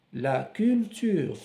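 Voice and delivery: ordinary talk, nothing marked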